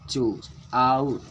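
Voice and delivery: चूज आउट slowly, with आउट held long; lengthened stressed word. Shown as a demonstration. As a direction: separate the two words and stretch आउट. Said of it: "थोड़ा देर के लिए पढ़े". (चूज आउट में आउट को देर तक खींचकर)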